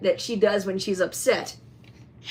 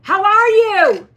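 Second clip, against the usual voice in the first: high, loud, drawn-out how are you; loud greeting; 11 semitones higher, 10 dB louder, slower than usual. Real, not acted. Owner loudly greeting the owl toy again. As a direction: stretch it out loudly to agitate her. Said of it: "My voice was a little too loud".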